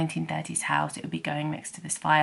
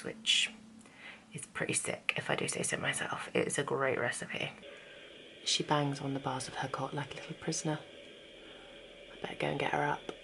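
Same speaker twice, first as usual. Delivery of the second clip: whisper; 10 dB quieter than usual. Real, not acted. Baby asleep, talking quietly.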